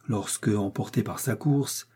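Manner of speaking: plain reading voice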